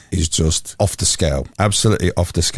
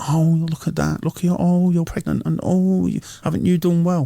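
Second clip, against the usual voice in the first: much higher, gushing mock congratulation; teasing impression of women fussing over a mum-to-be, pitched way up; women congratulating a pregnant woman; 9 semitones higher than usual. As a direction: coo over the bump like the ladies do. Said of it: "like the women do when they go up to the pregnant women".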